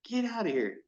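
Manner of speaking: playfully incredulous retelling; mock disbelief. Retold disbelief at friend's fishing claim.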